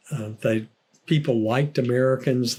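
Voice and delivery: ordinary delivery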